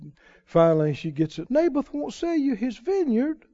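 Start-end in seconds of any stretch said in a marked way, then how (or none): 1.5-3.4 high incredulous question; high mock outrage; indignant wife. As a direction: act shocked for him. Jezebel surprised question.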